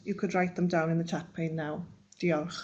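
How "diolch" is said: ordinary talk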